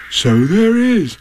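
drawn-out, marvelling bear voice; wondering Pooh. Acted. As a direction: stretch it out wonderingly. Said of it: "looking up wonderingly".

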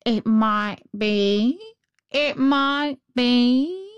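very slow, stretched crow voice, rising at the ends; thoughtful crow. A narrator's reading. Crow ponders the girl's question, slow and thoughtful. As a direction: draw each phrase out like a pondering crow. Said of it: "nodded very thoughtfully and said".

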